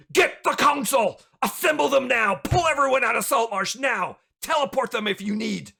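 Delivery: high, loud, alarmed commands; urgent scream. Acted. Alarmed elf screaming orders to his assistant, urgent shout. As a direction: shout orders as if every second counts. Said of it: "and he screams out".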